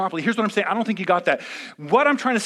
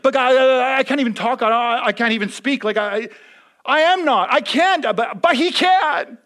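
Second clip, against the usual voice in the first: higher, louder anxious protest; pleading Moses; 6 semitones higher, 7 dB louder than usual. Retold or imitated. Moses telling God he can't speak, higher and pleading. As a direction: plead your weakness as Moses.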